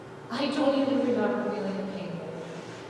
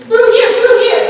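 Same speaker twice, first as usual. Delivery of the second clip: urgent shout, much higher and louder; screamed; 11 semitones higher, 16 dB louder than usual. A narrator's reading. Man at the torn tent wall, urgent shout. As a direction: call the crowd toward the hole. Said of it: "He screamed".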